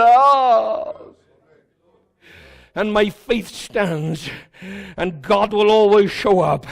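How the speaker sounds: usual speaking voice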